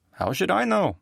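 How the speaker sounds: high, snappish retort; defensive woman. Acted. Rita brushing off Nick's question, high and defensive. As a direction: throw the question back at him.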